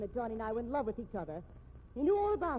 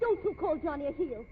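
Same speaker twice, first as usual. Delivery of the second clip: sharp rebuke; 4 semitones higher, 6 dB louder than usual. Acted. Defending her lover.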